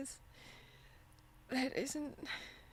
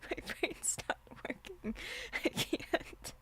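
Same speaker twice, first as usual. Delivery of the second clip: laughing; 4 semitones higher than usual. Real, not acted. Streamer flustered, laughing.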